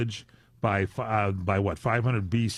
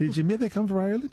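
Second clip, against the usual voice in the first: mock Irish accent; 8 semitones higher, faster than usual.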